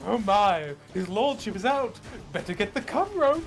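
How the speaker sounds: high, fussy, hammed-up maid voice; flustered servant. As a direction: panic like a maid hearing the master return.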